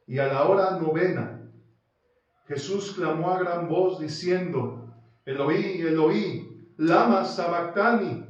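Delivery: loud, ringing reading of the cry from the cross; preacher declaiming scripture; impassioned scripture reading. Acted. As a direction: proclaim the verse with force. Preacher reads the crucifixion cry.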